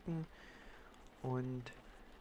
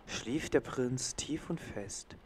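bedtime story reading. Acted. Reading a bedtime story to the baby in a hushed voice. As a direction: read it like a lullaby to a little one.

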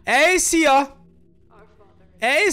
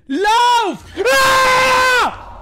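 terrified high yell; panicked scream; 13 semitones higher, 9 dB louder than usual. Real, not acted. Fleeing a monster in game.

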